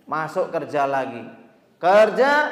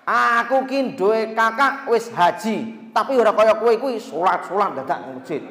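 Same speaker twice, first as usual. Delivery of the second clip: boss lecturing; 5 semitones higher, 5 dB louder than usual. Retold or imitated. Boss mocking the worker's mosque trips, higher and louder. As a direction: talk down to the worker.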